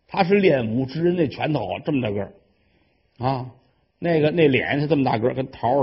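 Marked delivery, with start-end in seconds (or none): none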